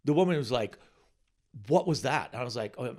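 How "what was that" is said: sharp, loud demand; furious client. Retold or imitated. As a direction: confront him about the set. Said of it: "she was furious".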